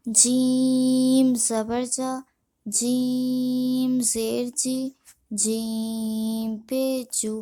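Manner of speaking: جیم held very long on its vowel, then the rest short; slow spelling drill, long-drawn جیم; elongated madd. Shown as a demonstration. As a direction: stretch the ee in جیم for five counts. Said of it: "مد ہوگا اسے ہم پانچ الف کھینچیں گے".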